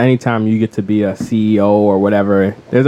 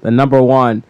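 epic introduction; 11 semitones higher, 4 dB louder, slower than usual. Pretend. Co-host introducing the episode's two contenders, epic announcer shout. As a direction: ring announcer's high, loud, drawn-out shout. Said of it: "thanks for that epic introduction".

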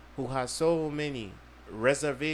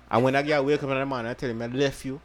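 loud, fast patois rant; louder Jamaican rant; 8 dB louder, faster than usual. Retold or imitated. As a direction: Jamaican accent shouted fast. Repeated louder faster.

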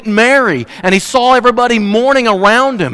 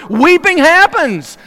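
drawn-out cry; 10 semitones higher, slower than usual. Real, not acted. Preacher drawing out the point.

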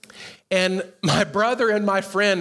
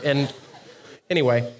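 usual speaking voice

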